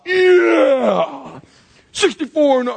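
a brawler crowing over a knockout; triumphant yell. Acted. Mall brawler crows after flooring a stranger, loud triumphant yell. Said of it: "The guy drops".

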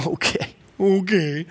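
slow, amused, knowing okay. Retold or imitated. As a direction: let the chuckle carry into the words. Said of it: "laughed under his breath".

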